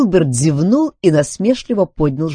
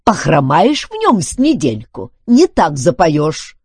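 mildly raised, warning voice; threatening; 5 semitones higher, 6 dB louder than usual. A narrator's reading. Duncan goes on threatening Filbert, warning. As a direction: drive the threat home, a little louder. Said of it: "пригрозил Дункан".

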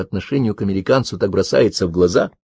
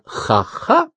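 very high, rising fake laugh; mocking ha-ha. A narrator's reading. Miyuki mocking Karen about his two-day stay, sceptical ha-ha. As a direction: scoff in a high, disbelieving ha-ha. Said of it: "она услышала заключительное «ха-ха»".

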